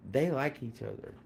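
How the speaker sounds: ordinary talk, nothing marked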